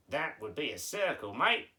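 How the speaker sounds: put-on Aussie puppet, rising on "mate"; hammed-up Australian character; Australian accent. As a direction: answer as a broad Australian. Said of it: "you're going with the Australian accent".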